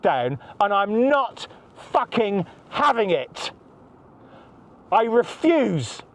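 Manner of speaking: slow and deliberate, every word weighed; slow defiance. Real, not acted. He refuses to back down and says so with slow, deliberate defiance. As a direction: dig in and refuse, slowly.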